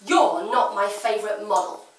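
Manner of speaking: loud acted complaint; loud outburst. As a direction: throw it out loudly at someone across the room. Character snaps at Celia aloud.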